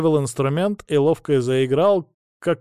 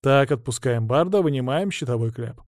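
ordinary delivery

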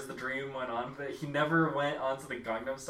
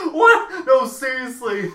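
high, loud mock-frantic shout; imitating someone losing it; retold freak-out; 9 semitones higher, 12 dB louder, slower than usual. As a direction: yelp it in exaggerated disbelief. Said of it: "he's freaking out".

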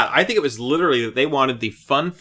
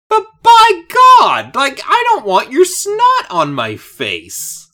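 much higher and louder outburst; disgusted outburst; 13 semitones higher, 8 dB louder than usual. Real, not acted. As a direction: burst out in disgust. Disgusted at Velma's snot.

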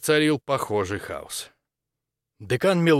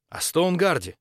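higher, eager blurt, lightly acted; unrestrained eagerness; 7 semitones higher than usual. A narrator's reading. Maximilian asks the dean about Stoneguard, eager blurt. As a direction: blurt it out, unable to hold back. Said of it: "не сдержался Максимилиан".

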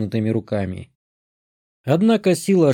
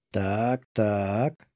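quiet, curious so-so; a soft intrigued murmur; 3 semitones lower, 7 dB quieter than usual. Acted. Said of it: "заинтересовалась Бесса".

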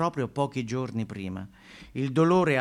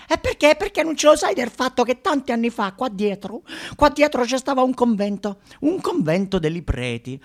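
very high, loud gossip voice; nosy neighbour confides local gossip, high and loud; gossiping elder; 12 semitones higher, 5 dB louder than usual. Retold or imitated. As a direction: spill the rumour like a nosy old neighbour. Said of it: "I più anziani ci raccontano".

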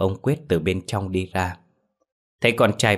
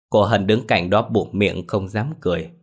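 ordinary delivery, nothing marked

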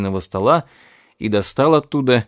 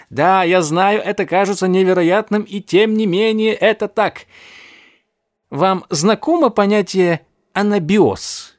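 higher, mocking scientist voice; gloating old professor; 8 semitones higher than usual. A narrator's reading. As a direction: sneer at him like a mad scientist.

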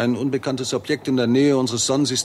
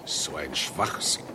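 low, slow, quiet grumble of contempt; disgusted scoff; 8 semitones lower, 7 dB quieter, slower than usual. Acted. Professor grumbling about the amateurs' claims on the drive home, low scoff. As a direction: scoff at the nonsense under your breath. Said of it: "ist Kingsley alles andere als erbaut".

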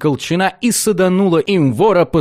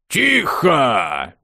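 sharp shout. A narrator's reading. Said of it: "прикрикнул хриплый".

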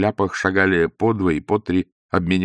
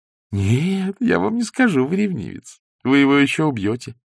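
put-on higher, girlish playful voice; lightly voiced young woman; 6 semitones higher than usual. A narrator's reading. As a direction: Zosya teasing Ostap in a girlish voice. Zosya's voice.

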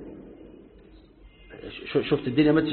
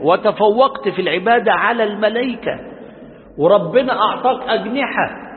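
usual speaking voice

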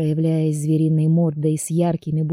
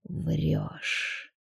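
hissed; 11 dB quieter than usual. A narrator's reading. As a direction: hiss it under breath. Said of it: "прошипел".

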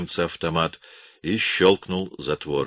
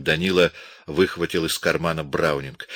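usual speaking voice

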